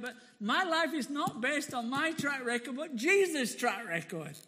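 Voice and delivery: loud, rising gospel proclamation; full-force preaching. Real, not acted. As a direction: preach it out to the whole room.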